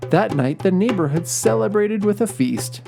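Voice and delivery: plain reading voice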